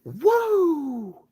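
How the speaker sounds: high loud Ric Flair whoa. Shown as a demonstration. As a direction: belt out a wrestler's whoa. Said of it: "I go".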